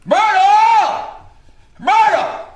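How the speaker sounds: high, loud, drawn bawling of a name. Acted. Dying husband bawling for his wife from downstairs, high loud. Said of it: "heard him bawling out".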